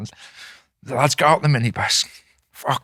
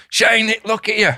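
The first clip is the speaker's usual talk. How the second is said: higher-pitched call for attention; mild mock shout, pitch raised; retold shout; 7 semitones higher than usual. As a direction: mimic someone calling out to a mate. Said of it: "someone shouts".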